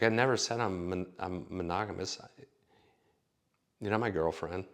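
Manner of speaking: ordinary talk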